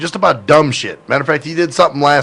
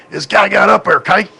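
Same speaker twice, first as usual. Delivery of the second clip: put-on higher-pitched, slurred country accent; thick southern accent; 6 semitones higher, 3 dB louder than usual. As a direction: imitate Southern "up there, okay" run together. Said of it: "country accent".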